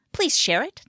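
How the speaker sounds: normal talking voice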